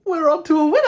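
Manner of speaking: thrilled, laughing shout after the first sip; laugh, then call out that the beer is a winner; delighted laughing exclaim. Real, not acted. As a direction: burst out laughing, then a loud, high cry of delight.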